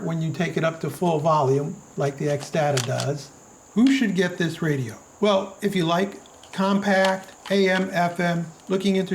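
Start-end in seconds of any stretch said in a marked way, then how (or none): none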